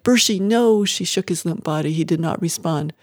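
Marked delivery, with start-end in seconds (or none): 0.0-0.9 raised, higher pleading cry; anguished cry. A narrator's reading. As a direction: cry out to a child in alarm. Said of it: "she cried".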